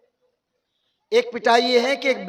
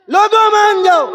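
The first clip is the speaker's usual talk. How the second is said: higher, louder pleading cry to the crowd; चीखता हुआ आलिम; 8 semitones higher, 8 dB louder than usual. Retold or imitated. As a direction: shout it out like a preacher begging people. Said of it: "चीख रहे ना". (ऊंची आवाज़ में चीखकर पुकारना)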